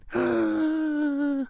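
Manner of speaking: long high gasp; mock gasp. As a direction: draw in a long, horrified fake gasp. Mock shock at week sixteen.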